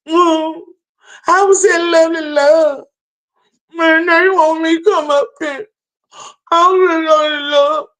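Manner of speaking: high, hammed-up fake sobbing whine; mock a crybaby whining about love; imitating a man whining and crying, pitched high; mocking whiny crying. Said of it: "listening to a motherfucker whine and crying".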